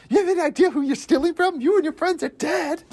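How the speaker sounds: high-pitched, worked-up gangster threat; outraged mob boss. Acted. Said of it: "that mob boss guy".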